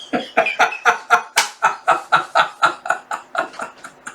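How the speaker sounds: wheezing giggle fit; high, voiceless helpless laugh; real laughter. Real, not acted. Laughing at child's tea cake question, real wheezy laughter.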